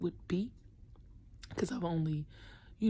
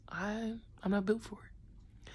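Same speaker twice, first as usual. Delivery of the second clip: ordinary talk, nothing marked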